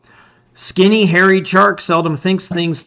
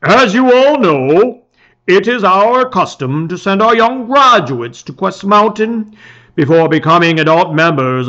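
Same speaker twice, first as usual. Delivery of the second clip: solemn village chief; 3 semitones higher, 6 dB louder than usual. Acted. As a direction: proclaim it like a proud chief before his people. Village chief addresses the crowd.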